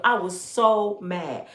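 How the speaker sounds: usual speaking voice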